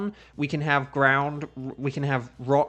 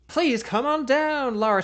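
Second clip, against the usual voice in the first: game show host; 11 semitones higher, 4 dB louder than usual. Pretend.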